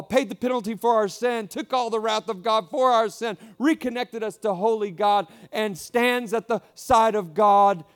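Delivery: loud, high, ringing praise; preaching at full force. Acted. Pastor exalting God to the congregation, full loud preaching. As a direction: lift the room with rising praise.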